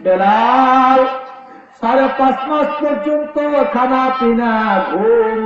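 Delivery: high, intoned preacher's cry; impassioned Bengali sermon chant; melodic preaching. Acted. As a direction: chant it high, holding the name long.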